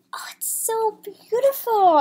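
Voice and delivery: awed whisper. Real, not acted. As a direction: hush it in wonder. Awed at artwork.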